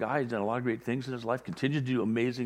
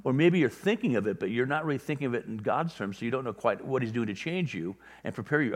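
ordinary delivery

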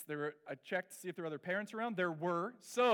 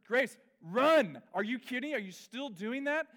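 loud, higher exasperated yell; intense scolding; 6 semitones higher, 6 dB louder than usual. Retold or imitated. Father scolds daughter on field, loud exasperated. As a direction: snap at her, fed up. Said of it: "getting intense and more intense with her".

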